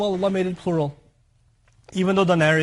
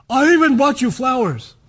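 higher, louder, huffy complaint; resentful giver; 7 semitones higher, 7 dB louder than usual. Retold or imitated. Spouse complaining to partner about flowers, indignant and loud. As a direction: throw the favour in their face.

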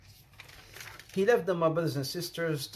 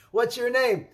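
laughing; 6 semitones higher, 5 dB louder than usual. Retold or imitated.

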